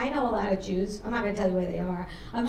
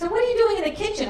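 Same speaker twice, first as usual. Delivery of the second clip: high, fast challenge; mother's comeback; 11 semitones higher, 5 dB louder, faster than usual. Retold or imitated. Mother challenging daughter in the kitchen, high quick comeback. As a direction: fire the question back, pitched way up.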